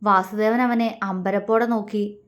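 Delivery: ordinary delivery, nothing marked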